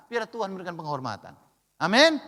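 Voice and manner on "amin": usual speaking voice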